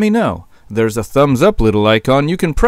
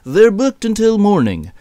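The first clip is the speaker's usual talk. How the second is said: lightly voiced higher-pitched shepherd; a hint of a boss turning someone down; higher-pitched reply from the shepherd; 8 semitones higher than usual. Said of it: "the shepherd replied".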